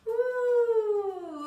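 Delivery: high loud cheer; excited whoop. Real, not acted. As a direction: whoop it out at the stretch. Big stretch cheer.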